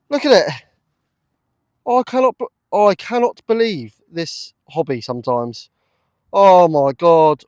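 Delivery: loud, high cry of disbelief; thrilled. Real, not acted. Detectorist marvels at the coin he just unearthed, loud and thrilled. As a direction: shout with amazed delight.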